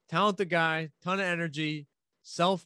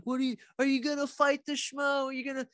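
high, far-off put-on fan voice, pitched way up; mocking fan question; 12 semitones higher than usual. A fan eagerly asking Helwani about fighting, high mock voice. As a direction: squeak out the fan's eager question in a silly high voice. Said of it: "Somebody asked".

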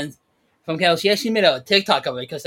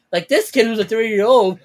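ordinary talk, nothing marked

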